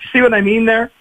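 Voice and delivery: ordinary talk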